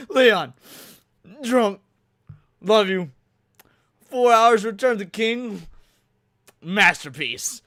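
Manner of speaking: put-on loud, slurred drunk guy voice; drunk Blue Collar Loser impression. Shown as a demonstration. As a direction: read it like a drunk friend. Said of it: "that's my Blue Collar Loser impression".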